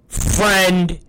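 usual speaking voice

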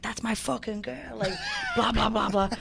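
half-hearted imitation of a cheering shout; retold shout. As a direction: yell it for your girl in the crowd. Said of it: "he was like".

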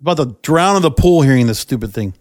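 normal talking voice

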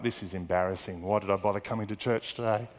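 low, soft mutter to oneself; embarrassed grumble. Retold or imitated. Man back in his seat after shouting in church, embarrassed grumble. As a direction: act out quiet regret, voice sunk down.

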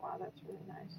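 faint whispered wow; whispered aside. Retold or imitated. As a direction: breathe it almost silently. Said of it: "I was like".

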